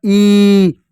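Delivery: slow, emphatic long ई sound. Shown as a demonstration. Teacher stressing the long ई so children hear it. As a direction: lean into वो and hold ई long. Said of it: "दादी खा बोलते हैं ई".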